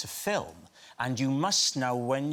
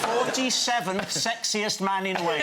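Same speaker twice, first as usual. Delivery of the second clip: dubbed voice; 9 semitones higher, 4 dB louder than usual. Retold or imitated. His own dubbed voice, punchline.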